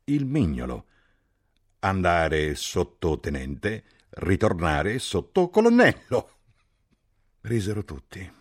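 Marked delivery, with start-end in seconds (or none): 1.8-6.3 slow, building to a louder, higher punch; slow joke punchline. A narrator's reading. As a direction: draw out the gag then land it. Captain's finger joke punchline.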